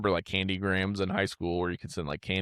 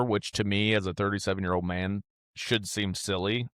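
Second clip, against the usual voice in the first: ordinary talk, nothing marked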